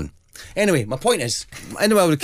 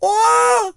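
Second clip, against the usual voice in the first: sudden high shrieking cry; hawk screech; 5 dB louder than usual. Retold or imitated. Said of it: "So he would make that".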